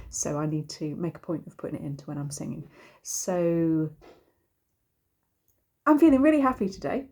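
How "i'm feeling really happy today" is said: much higher and louder, bursting with joy; believably happy. Shown as a demonstration. Singing teacher shows students a convincing happy line, beaming. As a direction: sell it so they believe you're happy. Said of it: "There you are. You might believe me".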